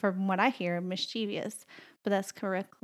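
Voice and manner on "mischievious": "mischievous" as mis-CHEE-vee-us; common mispronunciation. Shown as a demonstration. Wife quoting most people, "mischievous" as mis-CHEE-vee-us. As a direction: add an extra EE to "mischievous". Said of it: "incorrect completely".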